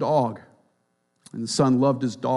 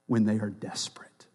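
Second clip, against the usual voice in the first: whispered; 5 semitones lower, 8 dB quieter than usual. Acted. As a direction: drop to a breathless whisper. Quoting Kidner, dropping voice.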